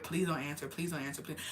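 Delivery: quiet pleading. Retold or imitated. In the principal's office, quietly begging dad not to answer. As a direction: plead under your breath. Hoping dad won't pick up.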